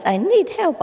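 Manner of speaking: very high squawking plea; high duck cry. Acted. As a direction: beg like a frantic duck.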